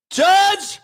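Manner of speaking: high, drawn-out mock-desperate yell; desperate pleading shout. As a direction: beg the judge at the top of the voice.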